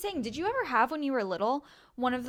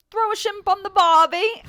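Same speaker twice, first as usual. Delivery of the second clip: laughing, higher, louder Aussie impression; mock Australian accent; 9 semitones higher, 12 dB louder than usual. Friend joking about accents, laughing mock Australian. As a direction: ham up the Aussie cliché through giggles. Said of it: "someone woke up and was like".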